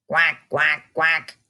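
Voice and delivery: playful mock duck quacks; hammed-up duck noises; duck quacking. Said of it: "Talk like an animal".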